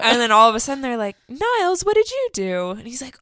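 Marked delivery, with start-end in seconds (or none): 1.3-2.7 curious high question. Retold or imitated. They ask Niles, high.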